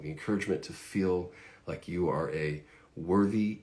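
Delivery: ordinary talk, nothing marked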